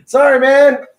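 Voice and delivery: high, loud apology through laughter; laughing apology. Real, not acted. Guest apologising to the host for crude talk, laughing loudly. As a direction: apologise while still cracking up. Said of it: "laughing apology".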